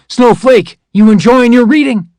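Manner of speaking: high, loud, sneering bark at a recruit; mock the soldier hard, then demand answers; livid drill sergeant. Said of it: "he was livid".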